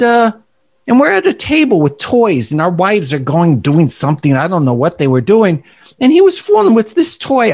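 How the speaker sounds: put-on storytelling elderly man with a New York accent. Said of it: "this is my best New York accent".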